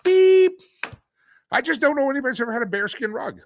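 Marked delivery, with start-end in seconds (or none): 0.0-0.6 very high, loud mock censor beep; imitated beep. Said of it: "Do we have the beep". 1.5-2.7 high, loud rewind and beep; flustered backpedal. Real, not acted. Self-censoring, calls for beep.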